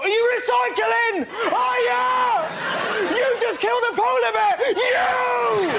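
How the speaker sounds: high, loud accusatory shrieking; Independent. Acted. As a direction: shout accusations as if grabbing someone by the throat. Like it's grabbed you by the throat.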